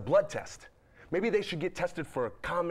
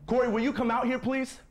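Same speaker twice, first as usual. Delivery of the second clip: higher, louder call; raised calling voice; 6 semitones higher, 4 dB louder than usual. Acted. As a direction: call to her in the next room. Husband calls through the door.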